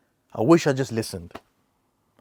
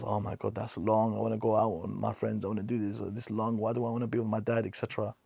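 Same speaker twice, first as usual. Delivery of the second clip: soft, lightly mocked bored grumble; mock whine; 7 dB quieter than usual. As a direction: mutter it like a sulky teen. Mocking son who is bored.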